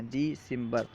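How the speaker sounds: دسمبر said softly as "dee-simbar"; Persian pronunciation. Shown as a demonstration. Teacher explaining the added ee, Persian pronunciation. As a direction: lengthen the first syllable of دسمبر to dee. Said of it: "دال کے بعد یا بڑھا دیتے ہیں".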